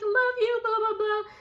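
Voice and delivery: high mocking falsetto; mock crying girlfriend. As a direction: squeak it out like a lovesick girlfriend. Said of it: "She's like".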